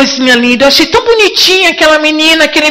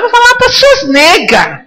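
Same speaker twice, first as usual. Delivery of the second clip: usual speaking voice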